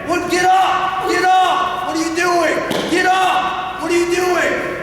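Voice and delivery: full-volume, high-pitched screaming; going nuts. Acted. Parent screaming at a kid pinned on the mat, going nuts. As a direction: scream it from the corner, completely freaked out. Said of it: "I see parents go nuts in the corner".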